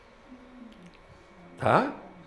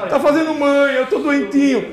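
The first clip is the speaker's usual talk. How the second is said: mock sick baby; 8 semitones higher, 5 dB louder than usual. Voicing the sick baby to mum.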